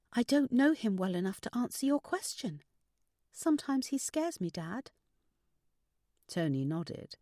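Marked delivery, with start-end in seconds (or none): 0.1-4.9 higher, confessing daughter voice; daughter's high, worried confession; anxious daughter. Acted. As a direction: admit fear to your dad, pitched up.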